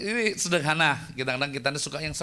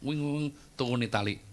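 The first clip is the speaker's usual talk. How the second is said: ordinary talk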